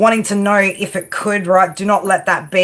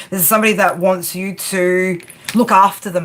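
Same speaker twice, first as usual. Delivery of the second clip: ordinary delivery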